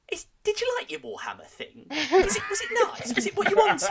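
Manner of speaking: high-pitched Northern English mum; hammed-up Northern English accent, high and laughing. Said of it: "given her a sort of Northern English accent".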